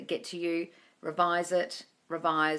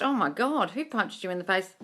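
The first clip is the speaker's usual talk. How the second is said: ordinary delivery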